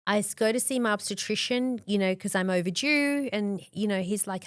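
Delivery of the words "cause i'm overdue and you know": ordinary talk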